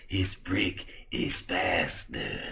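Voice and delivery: slow, hushed creepy doctor voice; creepy, drawn-out near-whisper; Victor. Acted. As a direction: drag it out eerily, softly. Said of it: "why do you talk like that".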